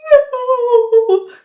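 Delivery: high, loud sobbing no; crying no. Retold or imitated. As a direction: wail out a crying no. Crying to boyfriend.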